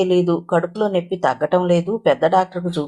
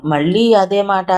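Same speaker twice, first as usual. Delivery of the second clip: usual speaking voice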